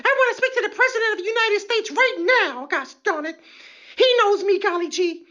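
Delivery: high shrill mock outrage; irate arrestee. Arrestee demands the president, high mock outrage. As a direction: screech with entitled fury. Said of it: "Then they really get irate".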